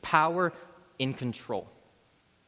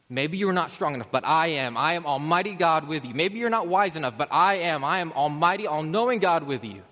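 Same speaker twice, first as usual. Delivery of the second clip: raised, ringing declaration of almighty power; forceful proclamation; 4 semitones higher, 6 dB louder than usual. Acted. Holy Spirit answering someone who feels too weak, forceful proclamation. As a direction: preach it out louder and higher, driving each phrase home.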